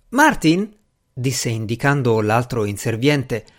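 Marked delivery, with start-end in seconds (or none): 0.1-0.7 high servant voice; high-pitched, protesting servant; aggrieved servant. A narrator's reading. Servant pointing out his colleague, aggrieved.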